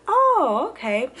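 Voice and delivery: high, drawn-out knowing "oh" as the nurse. Retold or imitated. Nurse hearing the patient's symptoms, knowing. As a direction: imitate a nurse who suspects something.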